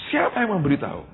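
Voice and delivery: high, indignant retort in imitation; mock angry demand. Accused man to the messenger about the informant, acted anger. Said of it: "Wah, marah sekali".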